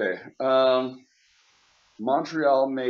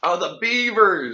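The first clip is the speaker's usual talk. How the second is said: excited; 5 semitones higher, 5 dB louder, slower than usual. Real, not acted. Excited recognition.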